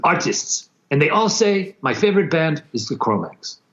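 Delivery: imitating the label boss. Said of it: "He's like, he's like".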